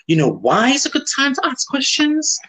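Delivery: high-pitched rhetorical tease up to "questions"; teasing build-up. Pretend. Rhetorical question repeated with mock excitement.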